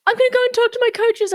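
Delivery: high, fast imitation of a panicking child; mock upset kid. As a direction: squeak it out like a distressed boy. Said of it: "crying and screaming".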